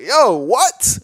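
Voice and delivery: high, loud startled yo; mock surprise. S0 acts himself stunned by Ben's story, high and loud. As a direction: act floored by the news. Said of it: "I'm like".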